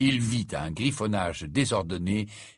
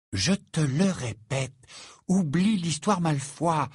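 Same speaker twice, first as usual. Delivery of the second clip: slow, higher-pitched firm insistence; ton ferme; 8 semitones higher, slower than usual. A narrator's reading. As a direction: repeat it firmly, stretching each word. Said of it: "d'un ton ferme". (ton ferme, lent et appuyé)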